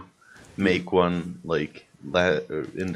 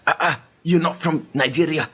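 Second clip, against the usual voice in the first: put-on Nigerian accent that slides toward London, pitched up; mock Nigerian accent; 7 semitones higher than usual. Friend mocking his co-host's claim in a fake Nigerian accent. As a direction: tease him in a raised, wobbly Nigerian put-on. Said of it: "That was your Nigerian voice".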